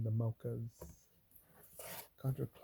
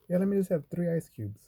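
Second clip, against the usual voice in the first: higher, louder fussy order voice; fussy customer; 8 semitones higher, 9 dB louder than usual. Retold or imitated. Pregnant lady ordering her drink, high and fussy. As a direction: play a picky customer's exact request.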